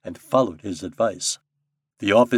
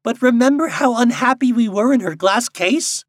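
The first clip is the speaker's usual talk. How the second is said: high, loud, earnest bird voice; grave robin; 8 dB louder than usual. A narrator's reading. Robin reminding Popopo of captivity, gravely. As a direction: plead the grievance gravely but forcefully. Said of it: "said a robin redbreast gravely".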